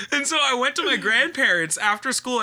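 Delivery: ordinary talk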